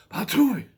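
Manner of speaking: loud high spitting "Patooey"; a loud, high spit; spitting sound. Acted. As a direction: spit it out with disgust. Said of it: "said Goldilocks".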